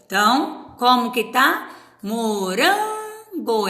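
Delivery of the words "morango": "Morango" with a long, drawn-out RAN; stress on RAN. Shown as a demonstration. Teacher showing it is paroxítona, "Morango" with RAN stretched. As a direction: hold the middle syllable of "Morango". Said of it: "é paroxítona também".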